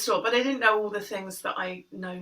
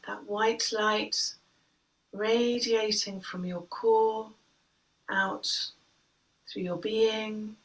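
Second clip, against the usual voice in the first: guided meditation. Acted. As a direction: guide the breath gently, unhurried. Leads breathing meditation.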